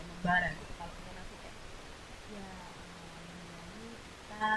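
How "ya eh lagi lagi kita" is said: low, much softer and limp; deflated low murmur, voice dropped. Shown as a demonstration. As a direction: let it sag, weak and unsure. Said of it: "ini, nada rendah kan itu".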